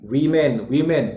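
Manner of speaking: वीमेन repeated as 'vee-men'; model pronunciation. Shown as a demonstration. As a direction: drill वीमेन with long 'ee'. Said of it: "याद रहेगा".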